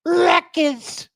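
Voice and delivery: high, drawn-out "RUTGERS" with a big roll; the "right way" shout. Shown as a demonstration. Host saying Rutgers the right way, high hammed-up holler. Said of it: "I gotta say it the, the right way".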